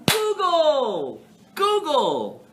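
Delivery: high, loud drawn-out call. Pretend. Teacher telling listeners to check for themselves, calling out. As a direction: holler it out, stretching the word. Calls out mock instruction to class.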